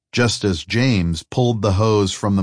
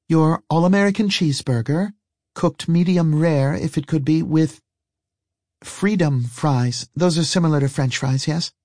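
higher, careful character voice; woman innocently ordering; 9 semitones higher than usual. Acted. Woman ordering from a waitress, higher-voiced with innocent care. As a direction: lift the pitch, feeling out unfamiliar menu words.

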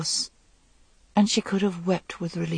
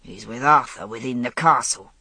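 lower, grudging giant's mutter; sullen; 6 semitones lower than usual. A narrator's reading. Giant sulking to the queen, lower and sullen. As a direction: sulk the words out, low. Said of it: "said Meleagant sullenly".

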